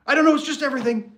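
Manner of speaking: hammed-up overwhelmed wife; distraught wife. Wife complaining vaguely to husband, mock distraught high voice.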